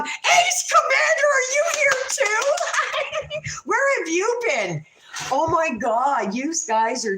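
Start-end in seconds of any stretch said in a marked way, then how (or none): 0.0-4.8 high, laughing delighted shout; overwhelmed surprise. Real, not acted. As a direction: squeal a happy surprised greeting. Greeting a viewer she missed.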